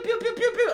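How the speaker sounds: high, laughing pew-pew blaster noises; laser pew pew. Retold or imitated. Describing ships firing, gleeful pew pew blaster sounds. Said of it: "they go pew, pew".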